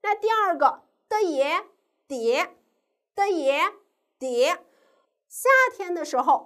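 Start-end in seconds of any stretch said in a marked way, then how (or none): 1.1-4.6 的耶 in two parts, then blended into 蝶; syllable blending demo. Shown as a demonstration. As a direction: sound out 的耶, pause, then 蝶 with a rising tone. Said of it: "我们可以怎么拼呢".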